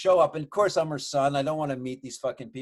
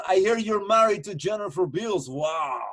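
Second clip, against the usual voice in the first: raised-pitch brash émigré voice; impressed, blunt Russian relative; Russian relative impression; 5 semitones higher, 3 dB louder, slower than usual. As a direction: play him as loudly amazed, pitched up. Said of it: "he's talking like this to me".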